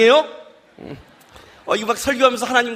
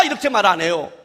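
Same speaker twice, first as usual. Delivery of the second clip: ordinary delivery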